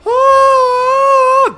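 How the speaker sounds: long, piercing high scream; hysterical scream. Retold or imitated. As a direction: let out a drawn-out shriek of panic. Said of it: "was just her screaming".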